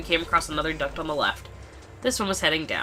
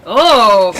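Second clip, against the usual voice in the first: very loud, higher-pitched roar; angry outburst; 5 semitones higher, 15 dB louder than usual. Acted. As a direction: burst out at full volume. Angry man bursts in.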